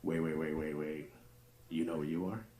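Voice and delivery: hushed, slow bedroom tease; soft slow dirty talk. Retold or imitated. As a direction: savour every word to her.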